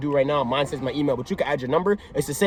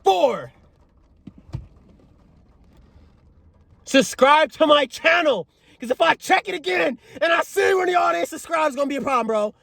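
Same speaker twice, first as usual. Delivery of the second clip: much higher, stretched-out mock hype voice; hammed-up hype host; 13 semitones higher, slower than usual. Telling viewers to subscribe, hammed-up high hype voice. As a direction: play a goofy over-the-top channel host.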